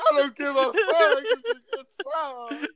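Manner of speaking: high, sobbing line breaking into tears; laugh-crying. Acted. Heartbroken guy insisting it's a good song while sobbing. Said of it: "bawling your eyes out".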